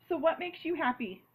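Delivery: ordinary talk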